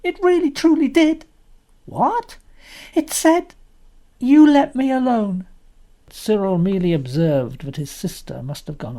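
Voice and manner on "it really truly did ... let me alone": put-on high, loud urgent girl; a loud earnest little girl pleading to be believed; earnest insistence. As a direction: insist breathlessly it really spoke. Anthea insists it spoke.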